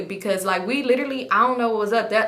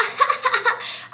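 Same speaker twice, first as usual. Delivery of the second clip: high, airy cackle; imitated laugh; 14 semitones higher than usual. Kelly reacting to being told to get out, imitated giggle. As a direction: act out her high giggle.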